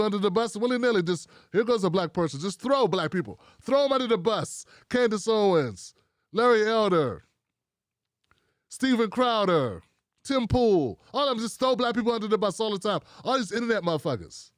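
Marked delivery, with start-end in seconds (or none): none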